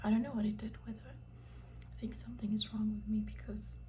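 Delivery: ordinary delivery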